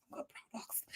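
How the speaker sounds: breathy whispered aside, almost no voice. Real, not acted. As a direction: say it under your breath like a secret. Whispered aside about products.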